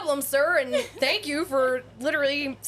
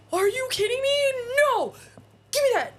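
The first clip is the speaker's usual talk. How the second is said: excited outburst; 10 semitones higher than usual. Retold or imitated. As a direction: burst out like a thrilled fan. Star reacting, grabbing to write.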